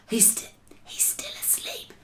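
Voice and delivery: very soft, breathy whisper. A narrator's reading. BFG to Sophie about the sleeping giant, whispered. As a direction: whisper it so nobody wakes. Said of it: "the B F G whispered".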